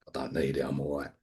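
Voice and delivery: low muttered denial to himself; dismissive inner mutter. Retold or imitated. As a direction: mutter it under your breath, low and dismissive. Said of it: "and I thought".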